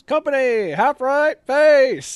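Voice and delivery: high, loud drill sergeant call; barked parade-ground order; drill command. Retold or imitated. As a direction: bellow it across the yard like a drill sergeant.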